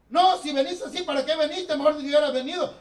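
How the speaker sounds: loud, high scolding; hammed-up nagging; scolding wife. A wife scolding her husband for how he came home, loud.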